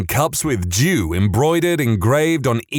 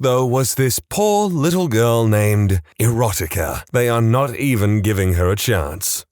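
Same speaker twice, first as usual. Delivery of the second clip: ordinary delivery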